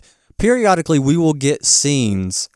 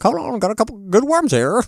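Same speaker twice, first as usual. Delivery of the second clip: high, squawky hen imitation; talking hen; 11 semitones higher than usual. Hen busy eating answers Dorothy, high comic voice. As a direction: put on a high chicken voice.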